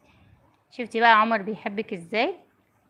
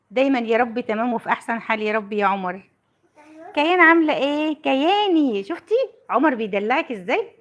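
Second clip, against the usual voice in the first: ordinary delivery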